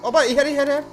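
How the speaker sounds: loud high excited yell; excited shout. Real, not acted. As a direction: yell it out in triumph.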